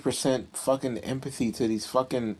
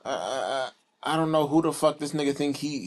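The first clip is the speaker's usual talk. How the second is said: ordinary delivery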